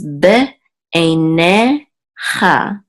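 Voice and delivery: "be einecha" slowly, with breaks between syllables. Shown as a demonstration. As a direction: sound out "be einecha" piece by piece. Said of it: "the accent is on ne".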